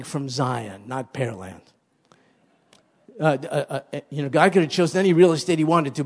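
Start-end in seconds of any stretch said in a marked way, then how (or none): 3.9-6.0 rapid aside. Acted.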